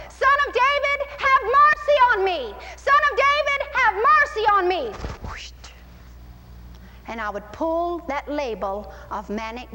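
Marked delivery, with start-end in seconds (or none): 0.1-4.9 high, loud plea for mercy; crying out. Shown as a demonstration. Preacher acts himself as the blind man, crying out. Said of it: "And I would go". 5.2-5.5 short rush of air, "whoosh"; whoosh noise. Shown as a demonstration. Preacher pulls the label off, whoosh noise.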